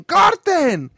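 high shouted cut; called cut. Retold or imitated. Director stopping the shoot, loud cry. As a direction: yell cut like a director on set.

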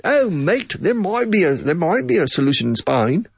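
a cheery Aussie mate, hammed up; Australian accent. Teacher imitating Australians, hammed-up Aussie accent. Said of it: "Now, in Australia".

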